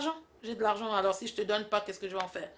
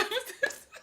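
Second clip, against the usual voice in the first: high laugh; 5 dB quieter than usual. Acted. Laughter after the punchline.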